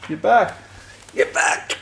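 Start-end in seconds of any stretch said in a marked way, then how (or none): 1.1-1.8 whispering. Real, not acted. Vacuum returns.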